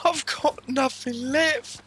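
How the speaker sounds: high, slow put-on voice; a light caricature, drawn out high; impression. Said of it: "really good impression".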